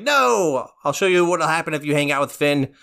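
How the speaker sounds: sudden high yell, then a lightly put-on cranky old king; a hint of a villainous old wizard scolding his son; Ice King shout. Ice King yells, retold scene.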